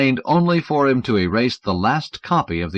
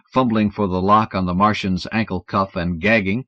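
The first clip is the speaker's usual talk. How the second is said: ordinary delivery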